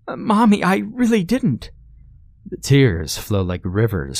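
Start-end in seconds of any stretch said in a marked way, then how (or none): 0.1-1.7 put-on high, pleading child's voice; a frightened boy protesting; scared little boy. A narrator's reading. Johnny pleads with Mommy, high scared child.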